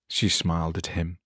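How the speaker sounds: plain reading voice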